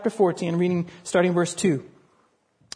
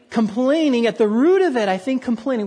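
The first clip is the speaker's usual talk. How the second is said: normal talking voice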